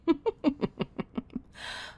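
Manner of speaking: breathy amused laugh; a light giggle under the breath. Acted. Amused laugh before teasing.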